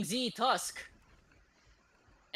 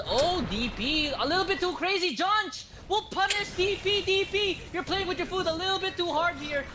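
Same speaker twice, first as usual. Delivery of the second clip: excited; 8 semitones higher than usual. Real, not acted. Caster hyped by a teamfight.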